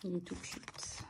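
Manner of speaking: tender little whisper; hushed and adoring; cooing whisper. Real, not acted. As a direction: whisper it softly to the cute card. Cooing over cute card.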